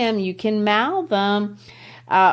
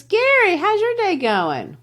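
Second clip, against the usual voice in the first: high-pitched teacher checking on a student; chirpy teacher; 12 semitones higher than usual. Acted. Teacher greets a student in class, high and chirpy. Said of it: "The teacher looks at you".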